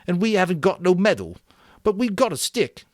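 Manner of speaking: put-on high, hurried fat boy insisting; Piggy voice, quick. A narrator's reading. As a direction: rush to make his point.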